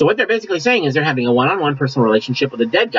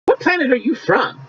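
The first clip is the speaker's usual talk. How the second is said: incredulous retort; 11 semitones higher than usual. Retold or imitated. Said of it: "I'm like".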